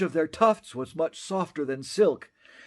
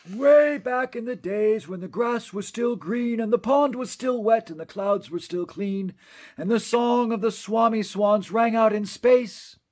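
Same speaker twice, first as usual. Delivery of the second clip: put-on higher, wistful old storyteller voice; old Onceler reminiscing; 8 semitones higher, 4 dB louder than usual. Onceler recalling the green days, wistful and higher. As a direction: lean back into long-ago memories.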